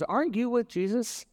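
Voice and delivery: put-on high rising question as the servant girl; lightly voiced girl, accusing question. Servant girl challenging Peter, high and rising.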